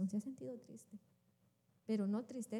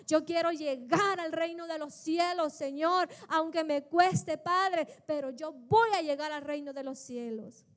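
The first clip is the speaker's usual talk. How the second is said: building exhortation turning into loud prayer; impassioned, emphatic pulpit plea; fervent preaching; 4 semitones higher, 7 dB louder than usual. Acted. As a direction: cry out to the Lord with rising force.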